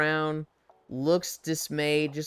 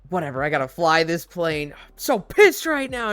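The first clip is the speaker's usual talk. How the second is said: mock fuming pilot; 5 dB louder than usual.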